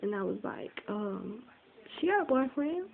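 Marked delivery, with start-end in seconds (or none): 2.0-2.9 high-pitched retold cover story; defensive fib. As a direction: protest the lie in a high voice. Retold lie covering for girlfriend.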